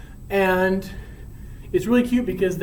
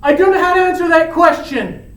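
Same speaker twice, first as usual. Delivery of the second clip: flustered; 9 semitones higher, 8 dB louder than usual. Acted. Romeo dodges her question.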